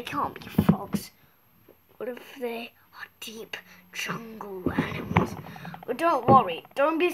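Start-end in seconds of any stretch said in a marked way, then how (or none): 2.0-5.3 softer scared whisper. Pretend. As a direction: whisper as if something lurks nearby. Pretend jungle fear.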